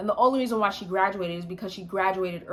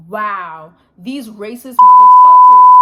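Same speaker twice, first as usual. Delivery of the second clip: outraged shout; 3 semitones higher, 20 dB louder, slower than usual. Retold or imitated. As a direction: scream out in disbelief. Outraged reaction to racist messages.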